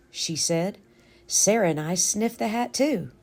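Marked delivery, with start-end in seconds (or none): none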